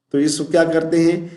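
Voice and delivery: ordinary talk, nothing marked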